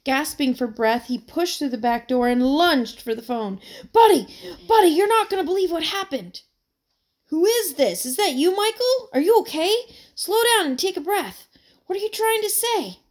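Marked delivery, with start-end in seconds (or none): 3.9-6.4 fast, loud and high, bursting with news; gasping out big news down the phone; breathless excitement. A narrator's reading. Boy phones his friend after running home, breathless and excited. 7.3-13.0 higher, louder, worried questions; calm the panicking caller down; concerned friend. A narrator's reading. Friend answers the phone, worried and puzzled, higher voice. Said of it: "Michael closed his eyes".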